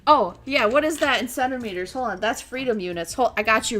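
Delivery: ordinary delivery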